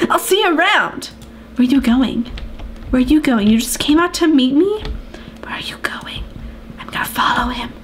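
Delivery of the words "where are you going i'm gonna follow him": whisper. Pretend. Stalking Alex.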